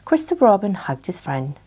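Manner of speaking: usual speaking voice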